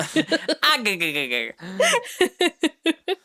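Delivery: stuttering cartoon sailor cackle; high, choppy "ah-guh-guh-guh" laugh; Popeye chuckle. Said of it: "Like your Popeye or something?".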